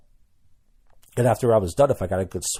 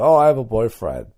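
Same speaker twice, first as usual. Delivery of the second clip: mock brush-off; 2 semitones higher, 8 dB louder than usual. Girl turning down a guy asking her out, mock brush-off. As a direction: toss off the stock excuse. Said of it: "they go".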